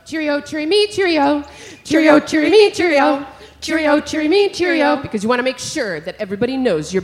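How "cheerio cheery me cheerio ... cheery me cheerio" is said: higher and much louder "Cheerio, cheery me"; loud dawn robin song. Shown as a demonstration. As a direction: belt it out to wake everyone. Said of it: "if it's at dawn".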